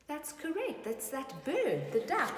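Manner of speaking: plain reading voice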